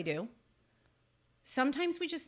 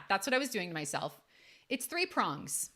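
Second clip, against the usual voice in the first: normal talking voice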